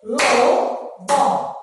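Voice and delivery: "Lobó" split into two loud beats; syllable by syllable. Shown as a demonstration. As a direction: beat out "Lobó" as Lo, pause, bó. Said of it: "bater palminhas pra saber quantas sílabas".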